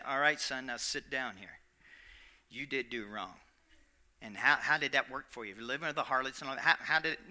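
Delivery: lecturing parent. Retold or imitated. A parent scolding the returning son, quiet and stern. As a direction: talk down to the wayward son. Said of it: "most of us would have said".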